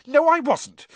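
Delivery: high, loud protest; offended, pitch leaping up; indignant denial. Acted. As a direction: deny it hotly. Hermione denies Ron's jibe.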